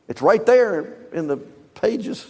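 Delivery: normal talking voice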